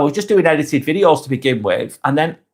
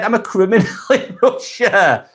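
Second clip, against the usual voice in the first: real laugh mid-sentence, higher voice; amused, laughing; 7 semitones higher than usual. Real, not acted. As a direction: laugh breaking into the words, pitched up. Amused reading of viewer's comment.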